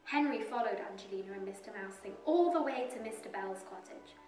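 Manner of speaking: usual speaking voice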